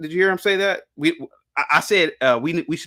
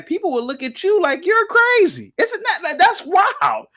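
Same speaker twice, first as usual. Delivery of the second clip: incredulous outburst; 6 dB louder than usual. Real, not acted. Host on people looking at family men like crazy, incredulous. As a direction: voice shoots up high and loud. Indignant at how believers are seen.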